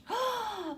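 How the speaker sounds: sharp high gasp; startled gasp; nervous gasp. Acted. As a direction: catch her breath in fright. Nervous on the phone.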